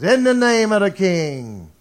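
loud, formal call from the doorway; royal summons. Acted. Footman at Mephibosheth's door calls out loudly and formally. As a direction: announce it like a herald at the door.

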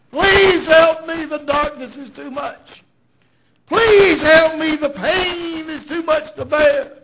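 high, pleading cry, acted out; begging for mercy. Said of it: "begged for mercy".

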